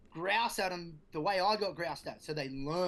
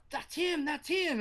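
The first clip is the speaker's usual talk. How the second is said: put-on much higher, kid-like voice pointing him out; kids accusing; 11 semitones higher, 4 dB louder, slower than usual. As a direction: squeak it like a pack of kids.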